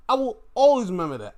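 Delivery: high, loud and fast repeat. Real, not acted.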